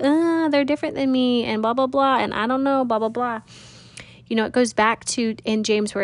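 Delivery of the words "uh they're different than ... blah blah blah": higher-pitched reluctant grumble; mock discomfort. Speaker voicing reluctance to love different neighbors, mock grumbling. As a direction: rattle off excuses in a raised, dismissive singsong. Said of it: "that discomfort of, like".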